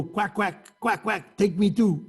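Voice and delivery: quacking like a duck begging to board; a mock duck begging for a place on the boat; pleading duck. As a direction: quack it out, then beg to be taken along. Imitating animal begging for ark.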